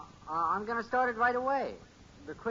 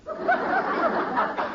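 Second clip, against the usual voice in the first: nervous laugh; 6 dB louder than usual. Acted. Bud nervous asking Dad.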